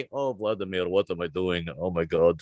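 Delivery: low, soft groan of parental despair; father giving up on his son, deflated and quiet. Acted. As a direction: sink into hopeless dismay.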